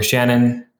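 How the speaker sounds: ordinary delivery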